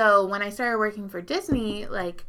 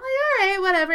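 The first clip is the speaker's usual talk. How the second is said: much higher, louder sarcastic shrug-off; mock dismissive; 11 semitones higher, 7 dB louder than usual. Narrator pushed aside by the lady, high sarcastic shrug. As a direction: brush it off with exaggerated sarcasm.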